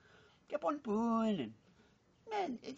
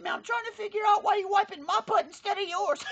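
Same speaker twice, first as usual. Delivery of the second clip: shouted, higher punchline outrage; outraged shout; 6 semitones higher, 8 dB louder than usual. Acted. Billy yells at Lilian over the mix-up, shouting. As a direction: yell it like you just caught him.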